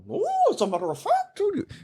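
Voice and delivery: very high, fast squeaky retort; Jordan Peterson. Acted. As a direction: squeak it out in a rush. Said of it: "Jordan Peterson kept trying to fucking steal my show".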